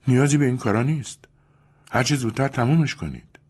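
cold Julia. Acted. Julia to Louis, curtly. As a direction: get it over with, coldly.

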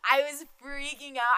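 laughing. Real, not acted. Laughing about stage panic.

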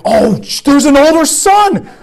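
put-on suddenly noticing an older son exists; startled listeners. Listeners to Jesus, startled, in mock surprise. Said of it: "they're like".

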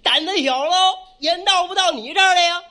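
loud, high, swaggering brag; swaggering bandit boast. Shown as a demonstration. Teaching his partner the bandit's loud swaggering reply. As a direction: puff up and brag like a bandit chief. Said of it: "我们老爷学横的".

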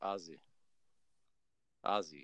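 "Ozzy" tried softly and low, with a z; low, careful "Ozzy", copying the correction; attempted Aussie pronunciation. Shown as a demonstration. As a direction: practise "Ozzy" quietly after the teacher. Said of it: "It's just Oz".

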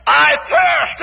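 a rising, pleading cry. A narrator's reading. Jesus on the cross, crying out his thirst. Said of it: "And then cried".

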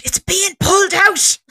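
very high, slow panicked wail; drawn-out, hammed-up squeal of alarm; mock panic. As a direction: imitate panic at its peak. Repeats, panic climbing.

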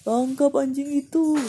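loud crowd yell; shouting. Acted. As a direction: shout to catch the dog. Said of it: "teriak orang di sekitar pasar".

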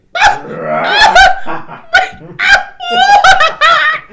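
loud, high imitation barking; hammed-up barking fit; frantic dog barking. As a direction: bark wildly like an excited dog. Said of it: "what that dog's like when he barks".

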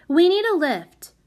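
high, loud, bossy announcement; announcing. A narrator's reading. Prudence to the unpromising carter, announcing. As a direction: state it loudly as a demand. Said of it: "announced Prudence".

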